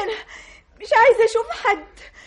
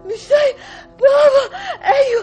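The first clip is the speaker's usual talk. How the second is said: crying that swells into shouting; sobbing scream. Acted. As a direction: scream it through sobs at your father. Daughter sobbing and screaming at father.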